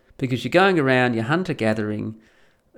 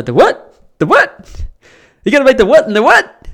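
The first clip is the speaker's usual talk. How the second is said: much higher, louder disbelieving yelps; incredulous; 11 semitones higher, 13 dB louder than usual. Acted. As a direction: shout the what in disbelief. Incredulous farmer questioning grifter.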